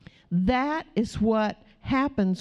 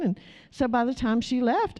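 usual speaking voice

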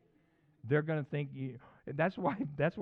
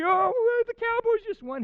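loud, very high elated whoop; hammed-up squeal of joy; happy; 11 dB louder, slower than usual. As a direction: cheer your team's win to the dog. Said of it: "I could be happy".

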